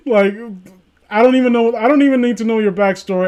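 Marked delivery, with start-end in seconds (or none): 1.1-3.3 mock Soviet official. Playing Russian official interrogating.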